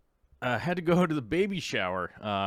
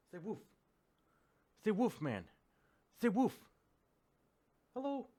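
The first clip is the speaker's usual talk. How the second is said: dog talk; 6 semitones higher, 6 dB quieter than usual. Real, not acted. Owner cooing softly at his dog on camera. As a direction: coo at the dog.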